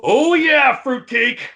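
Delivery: high, loud burst on the first words; sudden delighted recognition. Retold or imitated. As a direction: imitate a big guy happily recognising someone. Sid's sudden recognition, retold.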